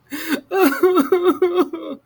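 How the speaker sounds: high bubbly laugh; giggle. A narrator's reading. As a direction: narrator laughing at the scene. Narrator laughs at crying.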